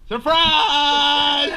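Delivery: loud surprise shout. Real, not acted. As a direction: yell it out. Surprise wake-up for birthday.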